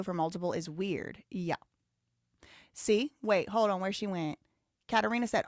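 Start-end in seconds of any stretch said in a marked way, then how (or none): none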